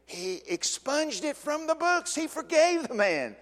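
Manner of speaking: high, slow, ringing proclamation; preaching at full force. Acted. Preacher to congregation, high slow proclamation. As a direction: proclaim the forgiveness.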